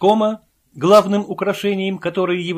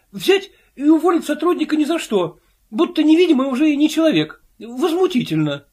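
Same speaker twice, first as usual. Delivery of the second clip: high, louder indignant outburst; 12 semitones higher, 5 dB louder than usual. A narrator's reading. Filyurin protesting his dismissal, high and indignant. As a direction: rail at the injustice. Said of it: "заметил Филюрин".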